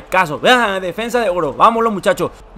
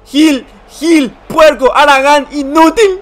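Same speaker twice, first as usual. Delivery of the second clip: laughing insults; 7 semitones higher, 8 dB louder, much slower than usual. Real, not acted. Insulting his player, laughing.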